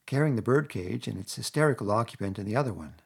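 ordinary delivery, nothing marked

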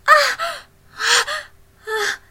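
high, loud breathy moans of pleasure. Acted. A woman moaning loudly to her lover. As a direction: let out sharp, high moans. Aroused moans in intimate scene.

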